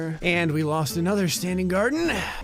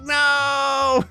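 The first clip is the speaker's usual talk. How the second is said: high mock shout of no; cat's cry; 8 semitones higher than usual. Gamer voicing the sad cat in a high mock shout. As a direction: wail it out high. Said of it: "Tontos is like".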